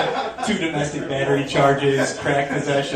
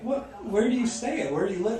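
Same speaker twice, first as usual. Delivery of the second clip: high-pitched, retold bafflement; baffled questioning; 7 semitones higher, 4 dB quieter than usual. Comic asking the club owner where he sleeps, mock baffled.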